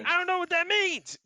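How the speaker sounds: very high, loud dismissive reply; gruff bar patron. Acted. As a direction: brush him off, unimpressed. Bar dude replies loud.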